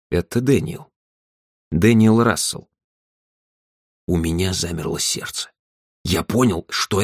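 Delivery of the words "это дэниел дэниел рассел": measured caller, pausing between phrases; slow, careful. A narrator's reading. Caller giving his name to Andrew, slow and careful. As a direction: introduce yourself carefully, piece by piece. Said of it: "Он говорил медленно и осторожно".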